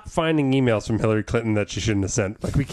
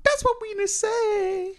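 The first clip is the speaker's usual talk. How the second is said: high, slow, squeaky repeat; slower than usual. Acted. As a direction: squeak it up slowly.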